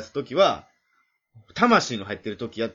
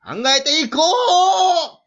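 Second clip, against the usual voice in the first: slow, much higher and louder, straining through laughter; mock strained shout; 11 dB louder, much slower than usual. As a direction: squeeze it out like a rallying cry. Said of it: "俺の喉ではもう表現できへんぐらい". (笑いながら絞り出す叫び)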